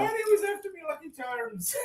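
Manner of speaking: laughing. Real, not acted. Cohost jokes, laughing.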